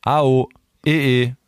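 A, O, E, E sung out slowly, only the vowels of Hallo Clemens. Shown as a demonstration. Demonstrating vowel-only speech to his co-host, slow vowels. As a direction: say just the vowels A, O, E, E, slow and drawn. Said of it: "Das heißt, „Hallo Clemens".

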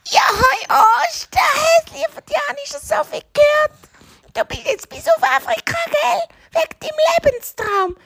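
very high screechy witch voice; excited witch. Acted. Witch welcomes the Easter hare, high and screechy. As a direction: greet your visitor in a witchy screech.

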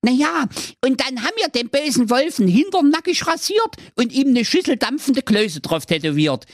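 sehr hohe, piepsige Zicklein-Stimme, leicht japsend; hoch und quäkig wie ein junges Zicklein; kleinstes Geißlein. Acted. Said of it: "Das kleinste Geißlein fuhr fort". (hohes Geißlein-Gepiepse nach der Drehtür)

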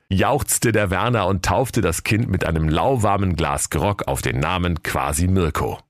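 normal talking voice

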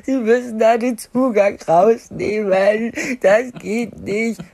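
slow, loud, wailing through tears; imitating tearful pleading. Crying new mother begging the nurse, slow tearful wail. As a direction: drag each word out sobbing. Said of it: "immer noch furchtbar geweint".

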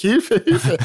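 high, rising mock cry for help; calling for help. Reaching the crew below, acted high cry for help. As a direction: yell for help as if panicking.

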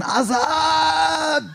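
high-pitched, drawn-out yell of a name; fan yelling. Retold or imitated. Fan yelling after his idol on the street, high drawn-out shout. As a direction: holler it high and stretch the last vowel. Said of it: "Da hab ich geschrien".

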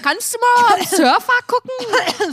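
high, slow mock receptionist asking for the Surfer. Imitating the doctor's receptionist Janine, high and slow. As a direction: put on a high old receptionist voice.

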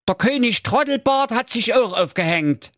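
high-pitched comic alarm; horrified report. Acted. Jakob reports another crash to Wilhelm, high horrified voice. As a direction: report it in a high, dismayed Saxon voice. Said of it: "rief der Jakob entsetzt".

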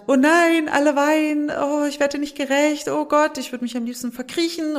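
put-on a caregiver losing it as all the children cry; overwhelmed panic. (panisch und überfordert, höher und lauter)

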